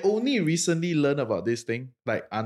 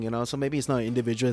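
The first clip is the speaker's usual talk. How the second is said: usual speaking voice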